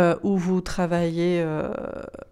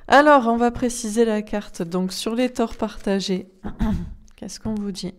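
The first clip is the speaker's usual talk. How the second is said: ordinary talk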